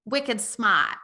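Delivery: Boston accent, slow, R dropped from "smart"; slow Boston-accent "wicked smart". Shown as a demonstration. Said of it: "in Boston they would say".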